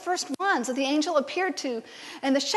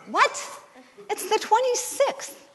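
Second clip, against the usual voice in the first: incredulous; 9 semitones higher, slower than usual. Retold or imitated. Shocked reply retold.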